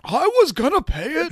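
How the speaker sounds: high-pitched mock protest; upset. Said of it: "Cheryl is pretty upset about".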